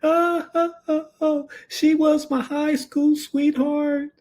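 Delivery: very high, slow wailing sobs of a grieving husband. Retold or imitated. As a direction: mimic a man crying out for his high school sweetheart. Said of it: "He's crying".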